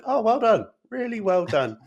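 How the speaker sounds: high, loud mock praise; sarcastic well done to the estate executor. Labourer mocking the executor, high sarcastic praise. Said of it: "Just completely sarcastically".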